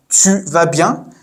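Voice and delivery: «Tu vas bien?» slowly, rising on bien; question intonation. Shown as a demonstration. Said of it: "with a question intonation".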